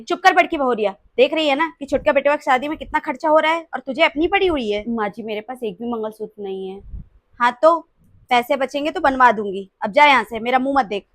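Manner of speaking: loud harsh scolding of the daughter-in-law. Acted. Mother-in-law scolds elder bahu. (सास का ज़ोरदार डाँटना)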